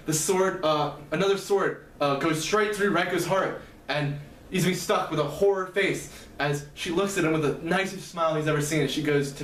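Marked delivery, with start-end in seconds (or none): none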